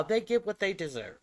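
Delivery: ordinary talk, nothing marked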